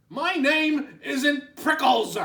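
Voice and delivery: high, loud, slow indignant yell; grumpy cactus yelling; yelled back. Acted. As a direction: bellow it back in outrage. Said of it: "Hank yelled back".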